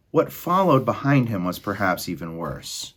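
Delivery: ordinary delivery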